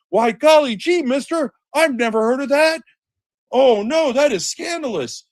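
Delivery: high, loud, wide-eyed golly-gee voice; wholesome 1950s kid. Pretend. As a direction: play a naive kid, shocked. Sarcastic naive feigned surprise.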